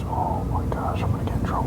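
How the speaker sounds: hushed, worried whisper under the breath. Real, not acted. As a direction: whisper it like you just slipped up. Realizes awkward date slip.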